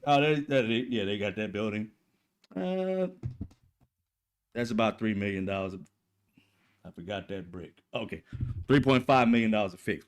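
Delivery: low, quiet, unbothered voice, shrugging off the damage; unbothered Iranian. Retold or imitated. Calm Iranians shrugging off bombing.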